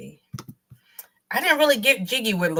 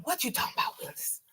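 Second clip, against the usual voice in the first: quoting the catchphrase; 8 semitones higher, 10 dB quieter than usual. Retold or imitated. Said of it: "What show did that come from?".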